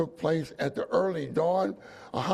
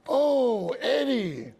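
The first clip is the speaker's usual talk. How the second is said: loud, high imitation of an excited wife; delighted exclamation; 7 semitones higher, 5 dB louder, much slower than usual. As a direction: exclaim the name like an overjoyed wife. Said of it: "Exclamation point".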